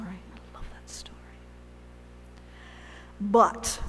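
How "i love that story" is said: whisper. Shown as a demonstration. Repeated as a whisper.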